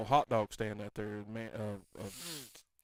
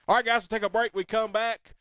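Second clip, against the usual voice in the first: radio host outro; 9 semitones higher, 7 dB louder than usual. Acted. Radio break announcement.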